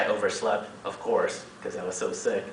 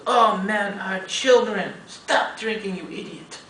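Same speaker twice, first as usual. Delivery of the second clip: higher, lightly put-on voice of a scolding older lady; mock scolding lady; 8 semitones higher, slower than usual. Teacher's wife stopping him from drinking, higher mock scolding. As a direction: playfully ham up her alarm.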